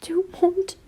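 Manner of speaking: tearful broken stutter; crying stutter. A narrator's reading. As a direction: stammer through tears. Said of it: "he cried".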